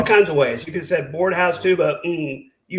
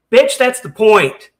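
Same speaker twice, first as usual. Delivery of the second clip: slow, louder, biting retort; angry retort; 4 semitones higher, 6 dB louder, slower than usual. Real, not acted. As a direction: drive the point home slowly and loudly. Heated argument with Carrie.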